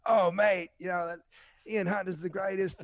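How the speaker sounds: high-pitched, delighted mock English accent; amateur English accent impression, opening loud and high. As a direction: imitate a thrilled English rocker, pitched well up. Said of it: "I've got a horrible English accent impression".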